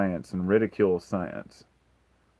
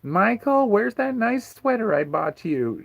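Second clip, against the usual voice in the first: high, louder mock mom voice; mocking his mom; 14 semitones higher, 4 dB louder than usual. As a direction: mimic a doting mom fussing over a sweater. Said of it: "she's like".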